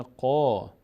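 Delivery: قا with a full, dark, rounded "qaw", held long; heavy qaf. Shown as a demonstration. Teacher showing a heavily read letter, heavy vowel on قا.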